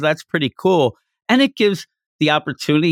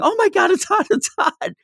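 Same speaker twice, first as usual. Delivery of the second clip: mock yell. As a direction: imitate a burned character yelping up high. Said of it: "he's yelling".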